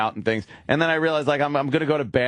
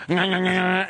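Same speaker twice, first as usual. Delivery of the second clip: high, nasal-consonant munching noises; mimed chewing; 6 semitones higher than usual. Retold or imitated. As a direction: imitate greedy chewing with quick nyah sounds. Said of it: "and go".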